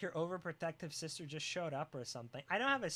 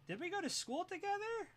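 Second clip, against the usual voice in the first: much higher, squeaky and baffled; high squeaky question; 12 semitones higher than usual. Pretend. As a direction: squeak it in mock panic. Mock paranoid questioning.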